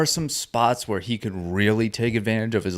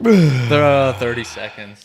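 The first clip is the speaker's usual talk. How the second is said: louder excited countdown; excited count; 2 semitones higher, 7 dB louder, slower than usual. Pretend. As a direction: call the final count with excitement. Announcer count climax.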